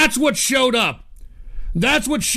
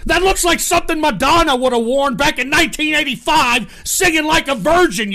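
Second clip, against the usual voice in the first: loud mockery; 6 semitones higher, 5 dB louder than usual. Real, not acted. Mocking rant about outfits.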